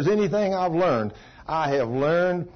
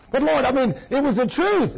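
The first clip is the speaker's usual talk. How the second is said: pleading whine; 9 semitones higher than usual. Retold or imitated. Gossiper pleads with the Lord.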